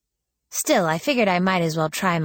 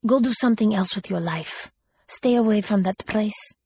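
put-on higher, accented urging tinged with sadness; sad warning; 5 semitones higher than usual. A narrator's reading. Older woman urging the girl to stay away, sadly. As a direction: urge with regret, in an accent. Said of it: "there was sadness in her voice".

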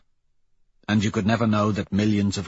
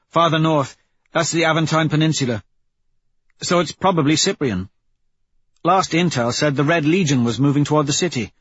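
higher-pitched squad member's report; Pebble, brisk crew report; 6 semitones higher, 4 dB louder than usual. Acted. Pebble tells the crew where the blast was, reporting. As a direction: read out the intel in a raised character voice.

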